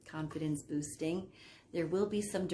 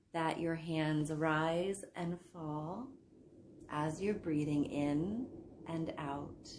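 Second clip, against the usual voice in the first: guided sleep meditation. Acted. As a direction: guide them down into sleep, softening as phrases end. Leads hypnosis for sleep.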